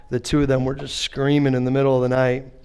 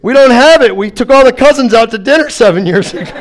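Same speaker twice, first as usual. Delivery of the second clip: loud exasperated protest; 9 semitones higher, 10 dB louder than usual. Retold or imitated. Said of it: "insurance dialog".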